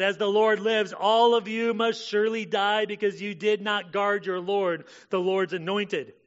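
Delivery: loud, high, slowed preaching rebuke; forceful scripture reading. Acted. Preacher reading David rebuking Abner's guards, loud and forceful. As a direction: thunder the rebuke over the guards.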